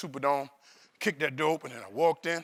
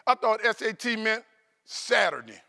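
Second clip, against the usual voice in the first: ordinary talk, nothing marked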